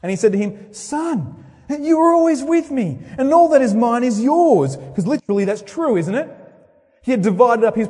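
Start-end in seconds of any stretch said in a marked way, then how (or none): 0.7-4.8 father voiced higher, pleading tenderly; loving father. Father gently pleading with his angry older son. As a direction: reach out gently to a sulking son. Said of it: "keeps coming to him in love".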